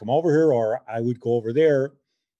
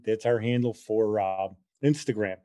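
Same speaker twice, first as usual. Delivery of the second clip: ordinary talk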